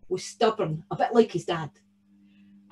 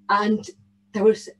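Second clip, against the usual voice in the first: plain reading voice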